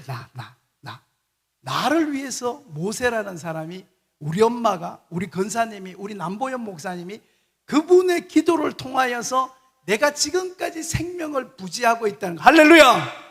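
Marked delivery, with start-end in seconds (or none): 0.1-1.0 whispered self-accusation. Real, not acted. Repeating "me" in a whisper. 12.4-13.2 shouted hallelujah. Real, not acted. Preacher's exclamation.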